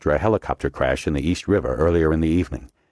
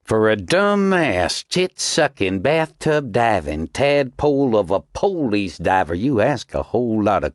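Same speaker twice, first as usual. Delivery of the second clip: contemptuous insult; 5 semitones higher, slower than usual. A narrator's reading. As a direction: spit out each insult. Commander insults Snow.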